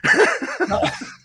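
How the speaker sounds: very high-pitched laugh; real high, delighted cackle; burst of laughter. Real, not acted. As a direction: crack up at the guest's story.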